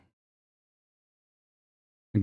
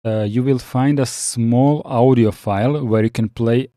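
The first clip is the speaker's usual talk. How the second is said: usual speaking voice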